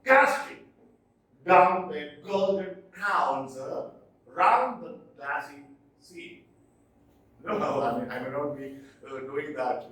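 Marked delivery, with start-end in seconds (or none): 0.0-6.4 teacher reciting a trochaic hymn line; poem recital. Shown as a demonstration. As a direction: declaim it with a strong beat on each first syllable. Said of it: "the first syllable is stressed".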